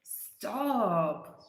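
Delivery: ordinary talk, nothing marked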